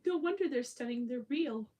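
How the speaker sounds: loud, high horrified exclaim; shocked realization. Retold or imitated. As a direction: blurt the horrible truth. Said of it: "I was like".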